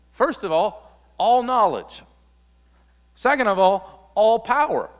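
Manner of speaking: high, loud, ringing proclamation; preaching at full force. Acted. Preacher to congregation on Jesus' knowledge and power, loud and soaring. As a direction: hammer each point to the crowd.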